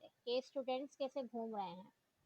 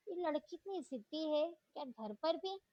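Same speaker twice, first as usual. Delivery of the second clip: plain reading voice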